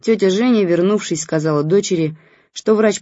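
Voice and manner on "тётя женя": usual speaking voice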